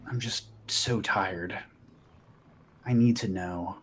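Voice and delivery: low, slow and tired; weary plea. Acted. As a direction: sound worn out and needing answers. Weary character pleads for answers.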